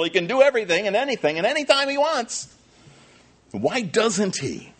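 high, loud, impassioned preaching; fiery sermon climax voice; full-force preaching. Acted. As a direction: preach it out, building to a cry.

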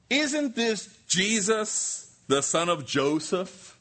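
high, drawn-out disbelieving question; mock incredulous crowd. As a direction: voice the sceptics, stretching each phrase up high. Voicing the doubting crowd's objection.

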